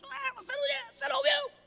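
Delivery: high, mock annoyed wife; wife's annoyed voice. Mimicking his wife scolding him, high and mock annoyed. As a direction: squeak out a bothered wife. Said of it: "ela fica brava comigo e diz".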